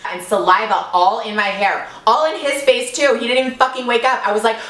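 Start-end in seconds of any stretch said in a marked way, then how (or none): none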